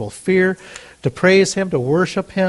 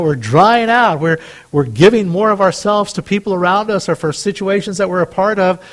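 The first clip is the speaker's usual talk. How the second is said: ordinary talk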